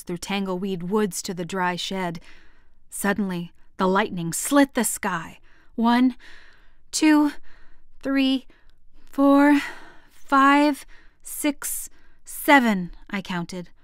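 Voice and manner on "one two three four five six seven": tense counting. Acted. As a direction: count each number tensely. Child counting during lightning.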